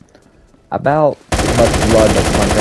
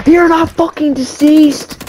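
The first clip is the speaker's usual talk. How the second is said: slow, much higher and louder screamed protest; screaming disbelief; 10 dB louder, slower than usual. Real, not acted. Gamer screaming at fallen teammate, furious scream. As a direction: howl it at the screen in outrage.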